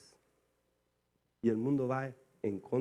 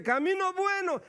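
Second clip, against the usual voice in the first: proclaiming God's word; 5 dB louder than usual. Acted. God voiced against the world.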